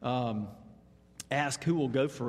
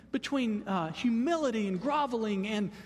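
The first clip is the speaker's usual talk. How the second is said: ordinary talk, nothing marked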